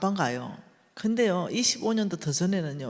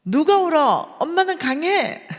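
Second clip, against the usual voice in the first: much higher, loud forced brave cry; defiant shout; 10 semitones higher, 9 dB louder than usual. Retold or imitated. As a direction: shout it to hide the tears. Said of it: "엄마는 강해야 되잖아요". (아이 앞에서 강한 척 외치는 엄마)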